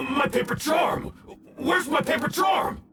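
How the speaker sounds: high, frantic cry; panicked. Acted. Villain discovering the charm is gone, frantic. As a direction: search in rising panic.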